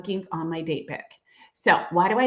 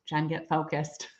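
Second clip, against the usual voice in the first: self-scolding; 3 semitones lower, 7 dB quieter, slower than usual. Real, not acted. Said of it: "let me stay focused".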